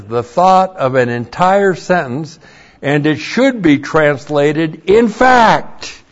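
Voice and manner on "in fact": IN FACT punched high and loud; loud, raised-pitch emphasis on "In fact"; emphatic. Shown as a demonstration. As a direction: drive home "In fact" with high, forceful stress on both words.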